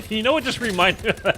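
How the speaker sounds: high, loud, laughing through the words; amused and breaking into laughter. Real, not acted. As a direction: crack up while getting the question out. Laughing at a sudden memory.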